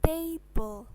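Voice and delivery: "table" said slowly as TAY-bol; English pronunciation. Shown as a demonstration. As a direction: demonstrate "table" with A as ay and a silent E. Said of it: "cara membacanya adalah".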